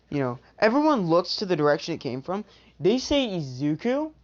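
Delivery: ordinary delivery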